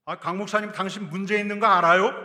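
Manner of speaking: loud, high accusation at the pastor; accusing challenge. Acted. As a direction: call him out to his face, loudly. Imagined accuser confronting the pastor. (목사에게 따지듯 큰 소리로)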